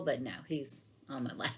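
hushed amused aside; whispered aside. Real, not acted. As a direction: drop to a whisper mid-sentence. Aside about the cat.

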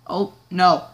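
ordinary talk

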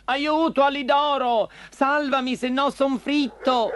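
high-pitched pleading puppet voice; desperate cry for help. Acted. Pinocchio begging Alidoro to save him from frying, desperate. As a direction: call out frantically for rescue.

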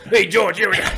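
Danny DeVito. Acted. Cartoon bird's voice turns into DeVito, loud impression. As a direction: belt it out as a comic impersonation, close to laughing. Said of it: "it turned to Danny DeVito going".

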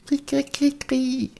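very high chirping kri; cricket chirp. Acted. A cricket chirping in the room, very high. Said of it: "ein Zirpsen".

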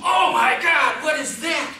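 high, loud panicked shout; alarmed shout. Pretend. Newborn baby shouts in panic at the thermometer.

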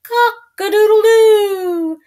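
high, loud rooster crowing. Acted. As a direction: crow it out from the barn top. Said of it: "He crowed".

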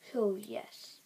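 whispering. Real, not acted. Quiet aside during pack opening.

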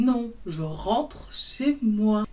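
very slow, spaced-out words; firm refusal. Retold or imitated. Woman refuses his cousin's place, slow emphatic refusal. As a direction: stretch every word to shut him down.

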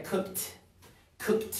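"cooked" as one syllable, kukt; "cooked" ending in a voiceless kt. Shown as a demonstration. Teacher showing learners the ending, "cooked" said kukt. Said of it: "Entonces se pronuncia".

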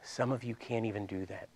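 ordinary talk, nothing marked

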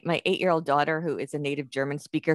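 ordinary talk, nothing marked

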